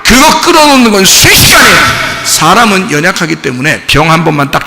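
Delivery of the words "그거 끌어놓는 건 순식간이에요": high, loud preaching cry; fiery preaching. Acted. Pastor preaching to the congregation, fiery and loud. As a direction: preach it out with force.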